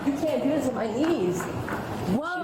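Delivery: panicked protest. Real, not acted. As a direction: protest in rising alarm. Protesting being walked off.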